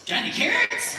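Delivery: high, rising cheeky question; spring the punchline, voice shooting up; cheeky rabbit punchline. Acted.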